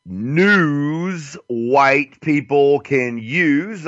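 slow, drawn-out announcer read; slow radio announcer intoning the show name; show title announcement. Acted. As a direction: stretch every word like a radio jingle. Slowly announcing the show's title.

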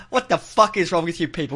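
normal talking voice

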